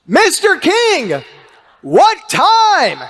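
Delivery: high, slow loud call. Retold or imitated. Fan calling to Larry King in his car, high slow call. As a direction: call through the car window.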